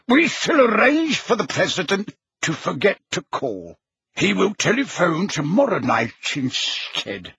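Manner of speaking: little man in a portrait, brisk and commanding; officious little portrait. A narrator's reading. Portrait overrules the Prime Minister, officiously. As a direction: give orders as a self-important painted official.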